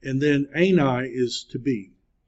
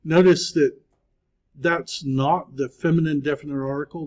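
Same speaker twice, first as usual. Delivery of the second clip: ordinary talk, nothing marked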